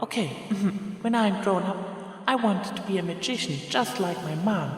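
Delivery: put-on a small girl bragging about her mom; little filly Trixie. Young Trixie's school report.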